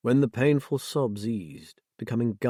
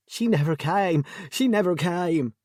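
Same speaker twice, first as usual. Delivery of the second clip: anguished, higher and louder wail; crying out; 7 semitones higher, 3 dB louder than usual. A narrator's reading. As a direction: cry it out in despair. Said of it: "Jessie cried out".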